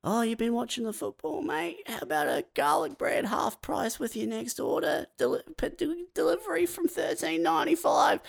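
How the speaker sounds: high, soft, wheedling sales voice; hammed-up salesman crooning a half-price offer; mock sales pitch. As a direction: sweet-talk a lonely customer into garlic bread. Domino's texts mock sales pitch.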